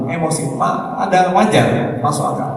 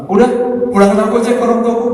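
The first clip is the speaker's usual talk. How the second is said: fast, higher mock outburst from an angry wife; a wife snapping at her husband that she wants to go home, fast and higher; 8 semitones higher, much faster than usual. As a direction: blurt it out like a wife fed up mid-quarrel. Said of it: "lagi marah".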